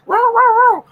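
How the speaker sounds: mock yappy puppy barks, very high; playful high bark impression; imitating a dog bark. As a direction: bark like a small excited pup. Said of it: "barking in my ear".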